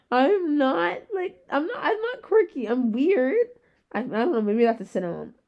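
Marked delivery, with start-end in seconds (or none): 0.1-3.5 much higher, laughing insistence; excited denial, pitched way up and louder; giggly protest. Retold or imitated. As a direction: squeal it out, half laughing. Protesting she's not quirky.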